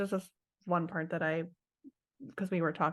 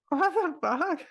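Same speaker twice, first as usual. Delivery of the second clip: shocked; 10 semitones higher, 5 dB louder, slower than usual. Retold or imitated. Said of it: "and I'm like".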